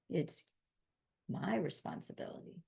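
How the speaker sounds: a hushed, intimate admission; whisper. Real, not acted. Owning up to viewers about personal responsibility, whispered. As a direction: breathe it out as a whisper.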